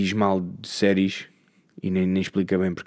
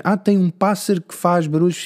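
eager friend; 7 semitones higher than usual. Retold or imitated. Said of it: "há sempre alguém que vê".

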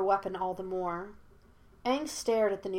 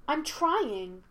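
put-on higher-pitched boyish protest; 6 semitones higher than usual. A narrator's reading. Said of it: "protested Aang".

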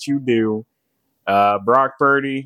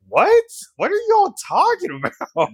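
high, incredulous retold question; baffled outburst. Friend retells hearing made-up slang, high-pitched and baffled. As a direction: burst out high in mock confusion.

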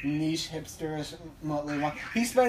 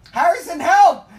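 mock call for help; 12 semitones higher, 15 dB louder than usual.

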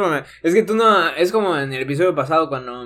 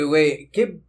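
ordinary talk

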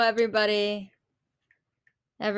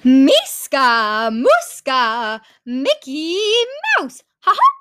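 louder cartoon mouse chant; Mickey Mouse impression; 2 semitones higher, 4 dB louder, slower than usual. Vlogger singing along as the cartoon mouse, louder. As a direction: belt out the cartoon mouse's call. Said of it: "that's my Mickey Mouse impression".

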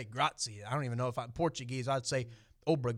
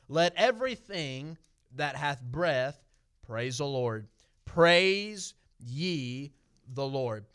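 scripture reading; much slower than usual. Acted. Reading scripture verse aloud.

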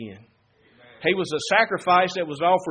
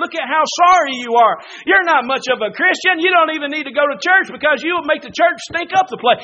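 taunting devil; 9 semitones higher, 8 dB louder than usual. Acted. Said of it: "he starts whispering in your ear".

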